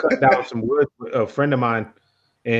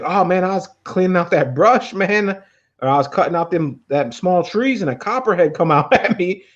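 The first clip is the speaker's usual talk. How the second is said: high, excited buddy voice; imitating buddy; 6 semitones higher than usual. Friend telling of the copperhead in a raised voice. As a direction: tell it like the worked-up friend.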